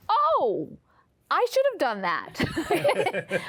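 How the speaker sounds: high drawn-out "oh", then laughing; retold self-reproach with a laugh at the end; mock realization. Mother laughing at her own oversight, mock realization.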